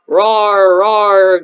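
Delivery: playful monster roar; huge fiery dragon roar. Pretend. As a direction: roar like a beast chasing prey. Pretend monster chasing someone.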